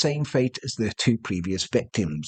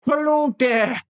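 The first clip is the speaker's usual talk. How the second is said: high-pitched, strange mock Irish accent; badly faked Irish accent; 10 semitones higher, slower than usual. Attacker greeting someone in a badly faked Irish accent. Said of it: "he spoke with a strange Irish accent, almost like he was faking it badly".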